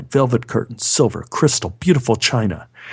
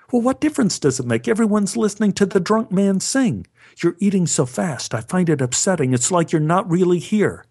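high, fast, exasperated complaint; upset wife; 7 semitones higher than usual. Retold or imitated. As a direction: rattle off her grievances, voice climbing. Wife complaining during dinner quarrel.

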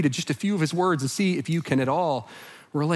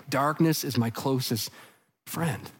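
low, hushed, somber reading; bleak resignation; 5 semitones lower, 7 dB quieter than usual. Acted. Preacher reading the psalmist's last words, bleakly resigned. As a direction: lower the voice, pause before the last word.

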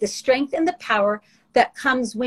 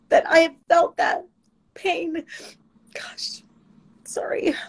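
really choking up, voice high then giving out; crying; 6 semitones higher, slower than usual. Real, not acted. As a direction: break down on the pain, barely get the apology out. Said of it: "I wasn't gonna cry".

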